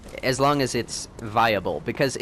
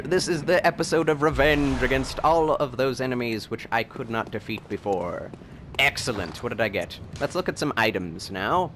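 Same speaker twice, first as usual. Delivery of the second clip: ordinary delivery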